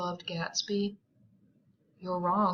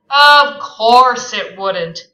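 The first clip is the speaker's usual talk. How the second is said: smug agreement; 3 semitones higher, 17 dB louder, much slower than usual. Acted. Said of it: "agreed Tom".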